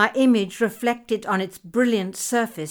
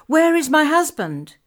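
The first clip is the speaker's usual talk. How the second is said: high, loud eager cry; cried out; 7 semitones higher, 6 dB louder than usual. Acted. Wife calls out for her husband, a loud eager cry. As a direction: call out for him with sudden urgency. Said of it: "I cried out".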